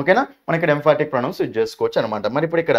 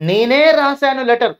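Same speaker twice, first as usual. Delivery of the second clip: నేనే punched higher and louder, slow; stress on నేనే; 6 semitones higher, 3 dB louder, slower than usual. Shown as a demonstration. Teacher to students, stressing నేనే loud and high. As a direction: lean hard on నేనే to say I and nobody else. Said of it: "నా మీద emphasis చేయాలి అనుకున్నానా".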